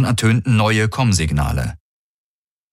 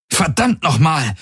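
high-pitched cry of frustration; exasperated call; 10 semitones higher than usual. A narrator's reading. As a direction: call out in exasperation, without real shouting. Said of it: "rief seine Projektion". (aufgebrachter, hoher Ausruf)

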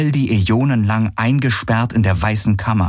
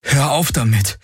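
higher, agitated command; angry protest; 6 semitones higher than usual. Acted. Man orders lamenting illusion to stop, agitated. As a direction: put a firm stop to it. Galen again rejects the illusion.

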